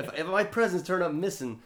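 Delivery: high-pitched mock indignation; warning in argument. Acted quarrel between cop and mother.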